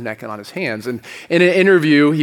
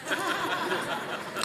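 a real high burst of laughter, softer; laughing; 14 dB quieter than usual. Real, not acted. Pastor laughing at his own pirate joke, a real high laugh. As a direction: crack up at your own punchline.

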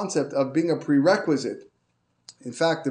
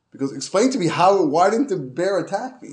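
high, loud, baffled why; stunned excited asking; amazed question; 6 semitones higher, 7 dB louder than usual. Retold or imitated. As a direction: demand an explanation in disbelief. Amazed man questions the tour guide.

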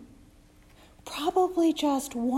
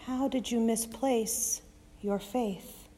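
a touch softer, gentle questions; 7 dB quieter than usual. Acted. Jesus asking the disciples, soft and gentle. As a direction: ask tenderly, not scolding. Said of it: "sticking with soft and gentle".

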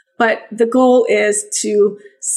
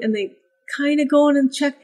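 ordinary talk, nothing marked

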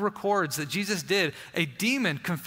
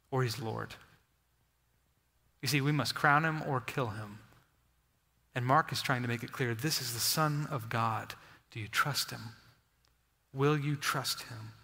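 hushed appeal; 5 semitones lower, 5 dB quieter than usual. Real, not acted. Preacher's quiet personal challenge.

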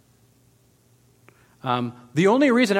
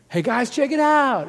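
excited announcing; 8 semitones higher, 3 dB louder, slower than usual. Acted. Healed man tells everyone.

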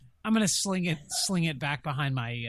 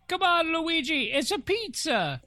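mock Italian pizza call; 13 semitones higher, 4 dB louder than usual. Player calls out in character.

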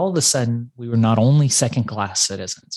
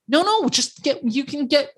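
high, casual insistence; breezy rich kid; 14 semitones higher than usual. Retold or imitated. Rich kid urging his friend to order more, high and casual. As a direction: wave him toward more food, pitched up. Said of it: "He's like".

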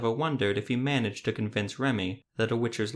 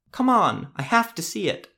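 much higher and louder, begging; pleading boy; 11 semitones higher, 6 dB louder than usual. A narrator's reading. Boy begging the witcher to see the monster, high and loud. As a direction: whine to be let through.

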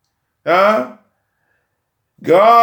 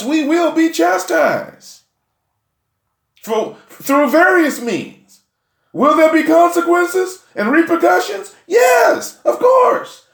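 preaching shout; 11 semitones higher than usual. Real, not acted. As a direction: lift it into a full shout. Preacher building to a climax.

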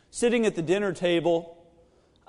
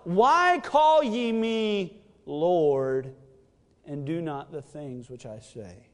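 slow, solemn reading of the verse; measured, reverent preaching voice; scripture reading; 4 semitones lower, slower than usual. Acted. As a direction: read the words of Jesus with weight. Repeating the verse.

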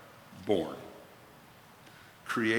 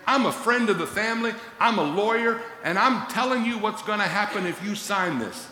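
high, quick, earnest lawyer voice; raised, urgent friend-of-the-family plea; friendly lawyer; 8 semitones higher than usual. Acted. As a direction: vouch for yourself like a family friend. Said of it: "Paul's basically saying".

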